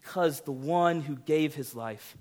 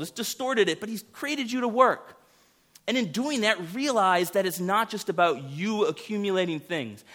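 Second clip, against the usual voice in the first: high, loud preacher's exhortation; fiery preaching; 9 semitones higher, 4 dB louder than usual. Acted. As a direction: preach it hard, calling people to work. Preaching at full force.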